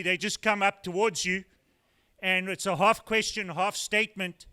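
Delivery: ordinary delivery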